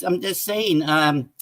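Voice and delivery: ordinary talk, nothing marked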